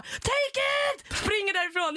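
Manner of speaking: high shout while running off; pressing money on the owner with a high shout. Retold or imitated. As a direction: yell it back over the shoulder. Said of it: "jag bara".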